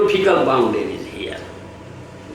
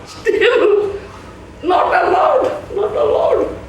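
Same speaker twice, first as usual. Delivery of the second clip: mock refusal; 13 semitones higher, slower than usual. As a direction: shout the refusal up high. Officials refusing entry.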